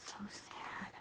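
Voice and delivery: breathy whisper of sympathy; barely voiced, almost to herself. Real, not acted. Quilter at her sewing machine whispers a sad aside, under her breath.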